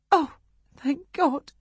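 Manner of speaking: high, breathless cry of relief; mother crying thank God over her child. Acted. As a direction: gasp it out as the fear breaks. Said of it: "she cried breathlessly".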